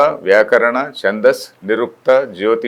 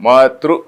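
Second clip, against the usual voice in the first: "Matru" said alone, a touch louder with a rising tune; emphasis on Matru; 3 dB louder than usual. Shown as a demonstration. Teacher isolating the stressed word "Matru" for the class. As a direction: lift "Matru" and let it ring. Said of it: "that emphasis is there on Matru".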